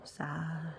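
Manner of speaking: soft moody sigh. Acted. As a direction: exhale sadly. Moody moose sighs.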